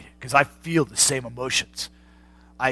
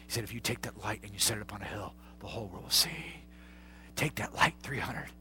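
soft, intimate pulpit whisper; hushed preaching; 3 semitones lower, 6 dB quieter than usual. Acted. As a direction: draw them close with a hush.

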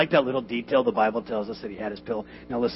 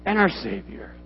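loud, high, slow preaching; full-force preaching; 11 semitones higher, 13 dB louder, slower than usual. Acted. Pastor preaching to his congregation, loud and slow. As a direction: thunder the point home to the church.

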